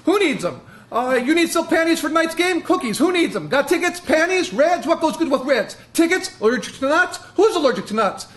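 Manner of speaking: high, pushy barking ticket tout; ticket scalper. Acted. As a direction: hawk tickets outside the arena, high and insistent. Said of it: "This is called Scalper".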